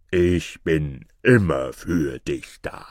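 low, slow giant voice. Acted. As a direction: intone it low and slowly as a giant. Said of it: "antwortete der Riese". (tiefe, langsame Riesenstimme)

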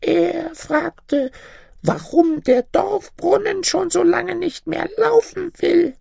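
put-on very high old woman voice; high-pitched little granny; old granny asking. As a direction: put the question in a piping crone voice. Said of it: "überlegte das Mütterchen".

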